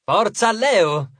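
big slow cheerleader call; rallying cheer. A narrator's reading. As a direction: lead the crowd in a drawn-out cheer. Leo whips up crowd's cheering.

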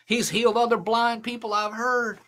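usual speaking voice

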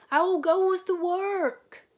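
high, loud, slow bellow from bed; lazy farmer calling. A narrator's reading. Lazy farmer calling from bed to the duck, high loud bellow. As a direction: boom it out like a lazy boss. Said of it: "he called".

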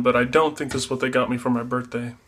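ordinary delivery

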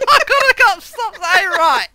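laughing. Real, not acted. Laughing at own word.

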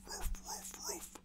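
Ruff, ruff, ruff as a small whispered bark; whispered bark imitation. Imitating the dog barking at the ginger cat, whispered barks. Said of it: "And he'll bark, go".

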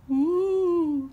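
high, loud ghostly wail; ghostly ooh. Acted. The little ghost cries out, high and loud. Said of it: "cried the little ghost".

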